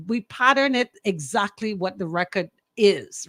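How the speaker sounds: ordinary delivery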